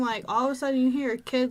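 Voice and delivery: ordinary talk, nothing marked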